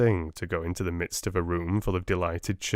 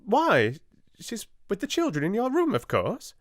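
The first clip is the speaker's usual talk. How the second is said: flustered; 12 semitones higher than usual. A narrator's reading. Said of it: "flustered Miss Amelia".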